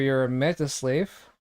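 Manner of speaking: normal talking voice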